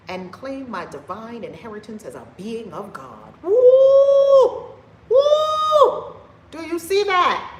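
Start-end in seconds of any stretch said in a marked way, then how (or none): 3.4-6.1 very high, loud whooping cry of joy; ecstatic whoop. Real, not acted. Ecstatic whoops mid-sermon. 6.5-7.5 excited. Real, not acted. Excited challenge to the audience.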